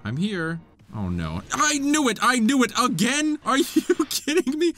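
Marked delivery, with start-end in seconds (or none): 1.5-4.7 high, indignant disbelief; high-pitched frustrated exclaiming; exasperated outcry. Real, not acted. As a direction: protest at being ambushed again. Ambushed by an enemy again.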